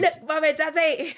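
high, hammed-up Liverpool kid accent; mock Liverpool accent. Mum voicing their future kid in a mock Liverpool accent. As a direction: play the future baby in a put-on Liverpool accent. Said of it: "don't even know what accent that was".

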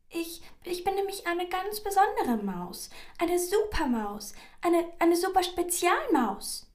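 high, loud, stammering mouse boast; desperate squeaky mouse. A narrator's reading. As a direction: talk yourself up frantically as a little mouse. Frightened mouse talks itself up.

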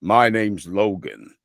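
slow cowboy drawl, sinking low; American cowboy. Shown as a demonstration. Showing friends his standard American, cowboy voice. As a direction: play a cowboy introducing himself, dropping deep at the end. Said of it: "cowboy, which is like the, the standard one".

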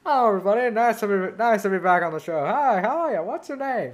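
high, showy genie greeting the crowd; a bright host-like genie welcome. Retold or imitated. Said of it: "he's like".

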